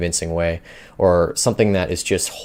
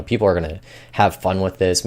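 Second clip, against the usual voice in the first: ordinary delivery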